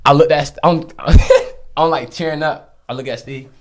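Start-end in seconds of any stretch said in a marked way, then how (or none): 0.9-1.7 sudden high, loud laugh; high laughing burst. Real, not acted. Remembering tearing up at church, high loud laugh. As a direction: burst out laughing mid-sentence.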